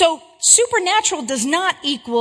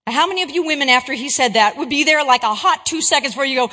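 usual speaking voice

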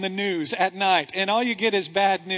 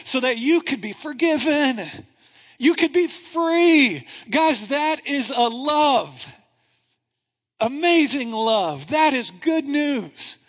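slow, higher, louder proclamation; rising sermon climax; fervent preaching; 4 semitones higher, 3 dB louder, slower than usual. Real, not acted. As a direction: preach it at full force.

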